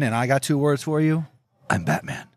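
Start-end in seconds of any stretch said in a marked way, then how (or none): 1.7-2.3 गहरी धीमी बैटमैन आवाज़ की हल्की नकल; low, gruff Batman line, lightly done; Michael Keaton Batman. Pretend. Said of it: "I'm gonna be Michael Keaton".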